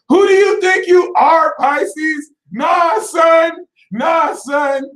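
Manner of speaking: high, loud, indignant shout; furious high call-out; angry shout. Real, not acted. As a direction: yell it right at the Pisces. Confronting Pisces directly.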